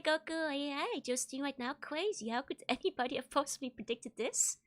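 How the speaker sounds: ordinary delivery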